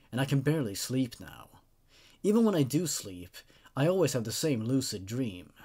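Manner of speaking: measured, grave storytelling read; creepy story narration. Acted. Narrator reading a creepypasta to viewers, measured and grave. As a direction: draw the listener into an eerie tale.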